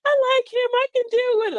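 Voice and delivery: girlish high-pitched imitation; high, sweet mock girlfriend voice; high girly voice. Mother-in-law quotes the girl.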